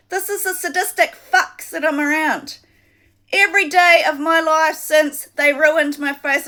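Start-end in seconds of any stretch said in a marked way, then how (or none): none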